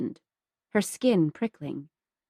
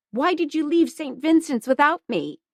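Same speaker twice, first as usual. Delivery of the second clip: higher, sharp demanding question; angry; 7 semitones higher, 3 dB louder than usual. Acted. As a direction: snap it out in sudden anger. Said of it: "surprised by her anger".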